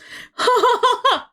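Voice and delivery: real laugh, high and loud; laughing after a swear; high burst of laughter. Real, not acted. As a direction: laugh in disbelief. Laughing at own exclamation.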